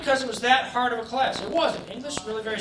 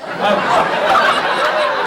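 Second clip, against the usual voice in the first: laughing um; 8 dB louder than usual. Real, not acted. Laughing embarrassment.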